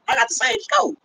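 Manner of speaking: high mocking voice, laughing; mock him with a laugh, pitched high. Mocking the other streamer.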